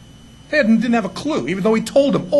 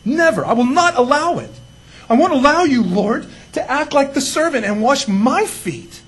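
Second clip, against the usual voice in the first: loud, emphatic acted refusal, higher in bursts; adamant refusal; 4 semitones higher, 4 dB louder than usual. Peter refusing to let Jesus wash his feet, adamant. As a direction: play Peter digging in, hitting each "never". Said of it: "Peter refuses that teaching".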